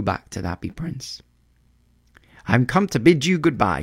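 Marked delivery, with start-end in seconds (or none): 2.5-3.8 raised, lilting bird voice; farewell cry. A narrator's reading. Swallow takes leave of the prince, crying out. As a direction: call out the goodbye, higher. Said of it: "he cried".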